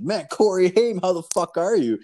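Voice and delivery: high, starstruck hello; excited greeting. Retold or imitated. The buddy greets Corey Haim at the Blockbuster with starstruck excitement. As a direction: greet him like a thrilled fan.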